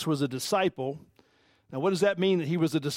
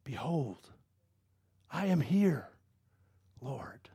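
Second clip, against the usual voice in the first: soft, lower "Behold, I am here, Lord", ending in a whisper; hushed reverent answer; 5 semitones lower, 6 dB quieter, slower than usual. Acted. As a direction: answer God in a near whisper.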